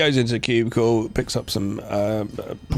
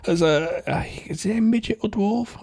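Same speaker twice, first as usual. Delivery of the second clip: mock amazed; 6 semitones higher than usual. Retold Waters voice.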